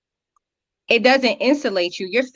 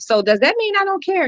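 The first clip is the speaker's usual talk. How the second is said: self-questioning thought; 11 semitones higher than usual. Retold or imitated. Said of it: "and we think".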